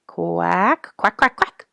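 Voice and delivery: high, loud duck quacks with a rising end; duck quacking. A narrator's reading. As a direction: quack like a friendly mother duck calling. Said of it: "Missus Mallard quacked an invitation".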